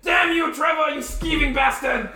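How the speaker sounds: high, hammed-up furious shout; yelled curse. Game master as the wounded Grung cursing Trevor, high yell. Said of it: "he yells out".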